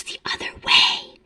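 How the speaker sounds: slow, voiceless conspiratorial whisper. Retold or imitated. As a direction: whisper a secret tip sideways. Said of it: "he swore she had whispered to him".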